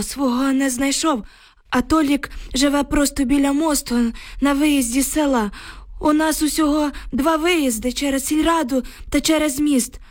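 put-on high-pitched old man's voice, upset; agitated old village man. A narrator's reading. Shaken neighbour reports to the women, agitated old man.

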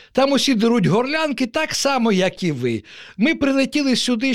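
high-pitched, put-upon fish; peevish complaint. A narrator's reading. Peevish fish complains about loud neighbours.

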